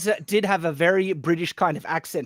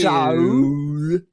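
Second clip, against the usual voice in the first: Australian accent, louder, vowel stretched; the broad Australian so, every vowel in it; thick Australian so; 2 semitones lower, 5 dB louder than usual. Shown as a demonstration. Australian man showing the broad accent, louder. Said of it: "they make it sound like every single vowel".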